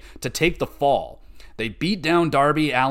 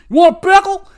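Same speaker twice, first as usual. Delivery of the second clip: mock pickle salesman; 11 dB louder, faster than usual. Mimics MJF selling pickles.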